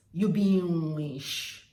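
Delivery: "witch" stretched loud into "weesh"; Warri pronunciation. Shown as a demonstration. Demonstrating the saying to viewers, loud and drawn out. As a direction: drawl "You be witch" loudly, sh at the end. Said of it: "That is how they say it".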